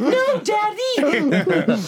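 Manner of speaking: high, slow laughing squeak; laughing squeal. Pretend. Joking reply, laughing.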